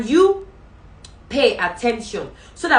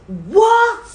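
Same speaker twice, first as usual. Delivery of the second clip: high, loud, held what; a sharp royal what of outrage; shocked king; 13 semitones higher, 10 dB louder than usual. Acted. King hears Esther accuse Haman, shocked shout.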